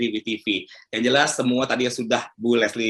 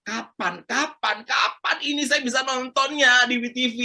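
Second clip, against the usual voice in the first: high-pitched eager pleading of a fan; playfully impatient; 10 semitones higher than usual. Acted. Host voicing viewers who can't wait for the series, impatient. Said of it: "nggak sabar".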